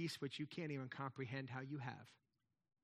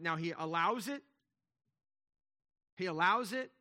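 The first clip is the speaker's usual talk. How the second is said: louder, building fervor over God's design; preaching at full force; 6 dB louder than usual. Real, not acted. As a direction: press it home like a pulpit climax. Preacher rising to a climax.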